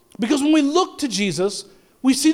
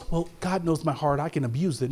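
careless excuse; 8 semitones lower, 7 dB quieter than usual. Retold or imitated. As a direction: lower and softer, a lazy self-excusing shrug. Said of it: "you don't go".

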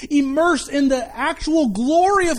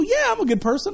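confident reply; 8 semitones higher than usual. Retold or imitated. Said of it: "They go".

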